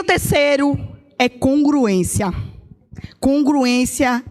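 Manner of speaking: slow, deliberate congruência; slow announcement. Real, not acted. Preacher names her third point, slow and deliberate. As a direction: lay the word down slowly.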